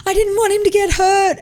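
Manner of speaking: put-on very high, upset little-kid voice; tearful child imitation. Son explaining himself to mum, imitated tearful squeak. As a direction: plead like a small child near tears. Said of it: "he started bawling his eyes out".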